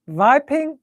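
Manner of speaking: "Viping" with an opening V; high "Viping", W made a German V. Retold or imitated. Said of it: "So we went from viping".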